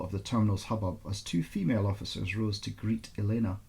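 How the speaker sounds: ordinary delivery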